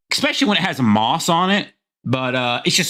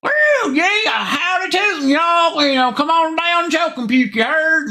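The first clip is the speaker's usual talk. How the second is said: mock Southern accent; 11 semitones higher than usual. Streamer mocking how Southerners talk, exaggerated Southern accent. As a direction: parody a Southern yokel with a Southern accent. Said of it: "we all don't talk like Southern assholes".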